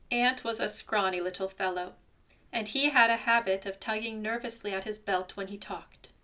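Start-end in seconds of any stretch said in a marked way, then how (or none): none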